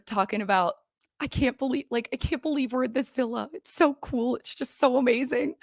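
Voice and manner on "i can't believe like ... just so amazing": high, bubbly mock cheer; chipper Andy. Mimicking chipper Andy gushing about the villa. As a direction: play him as giddy and thrilled. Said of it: "Andy's, like, all chipper".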